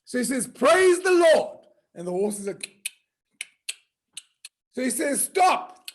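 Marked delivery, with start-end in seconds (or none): 0.5-1.7 high, loud and slow; loud proclamation. Acted. Rider commanding the horse with a loud slow proclamation. 5.2-5.9 high, louder call to stop; sharp stop command. Acted. Rider calling out to the horse, high and loud.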